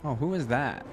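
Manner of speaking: usual speaking voice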